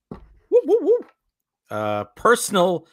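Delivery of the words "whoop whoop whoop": high loud stooge whoop; woo-woo-woo like Curly. Retold or imitated.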